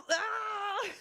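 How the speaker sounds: high-pitched squeal of embarrassment; embarrassed squeal. Real, not acted. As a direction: squeal helplessly at the memory. Squeals recalling own embarrassment.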